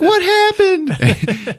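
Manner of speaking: very high, drawn-out mock shriek; alarmed mom. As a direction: cry out as a frantic mother. Voices mom reacting to crying child.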